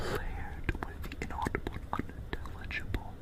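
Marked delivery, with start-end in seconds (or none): none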